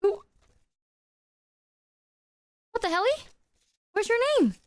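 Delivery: high, startled disbelief; shocked. Real, not acted. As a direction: squeak out the surprise. Shocked the gifter is anonymous.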